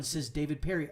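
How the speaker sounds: usual speaking voice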